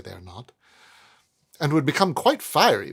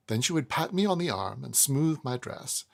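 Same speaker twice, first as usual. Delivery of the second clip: ordinary delivery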